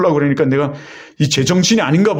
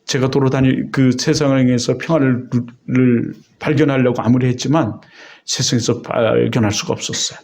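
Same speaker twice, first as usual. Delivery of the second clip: usual speaking voice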